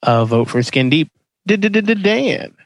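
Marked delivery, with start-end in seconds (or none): 1.5-2.5 high stuttered name call; announcer stutter. Pretend. Host calling on Dan with a high stuttered intro.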